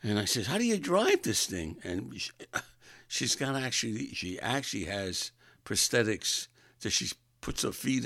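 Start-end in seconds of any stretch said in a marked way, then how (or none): 0.5-1.8 high, loud astonished question; baffled, raised-pitch retold question; incredulous question. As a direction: blurt it out in amazement.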